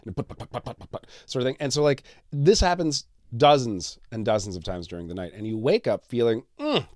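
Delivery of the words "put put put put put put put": soft sputtering put put put like a dying engine; motorboat put-put. Retold or imitated. Imitating breathing like a sputtering old motorboat. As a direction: imitate a failing outboard motor. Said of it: "like an old motorboat whose engine is, like, just on the fritz".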